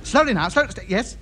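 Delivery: ordinary delivery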